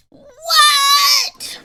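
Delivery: high, loud, drawn-out outcry of disbelief; shocked shout. Acted. Game character reacts to the murderer claim, shocked high shout.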